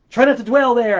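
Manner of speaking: loud insistent self-reminder; emphatic. Real, not acted. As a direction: hammer home the not.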